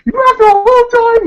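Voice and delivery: shrill high fast shout; hammed-up outraged scream; furious yell. Retelling her pissed-off yell.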